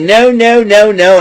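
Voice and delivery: hammed-up panicky refusal; mock refusal. Chelsea Green refusing Charlotte, hammed-up panicky nos.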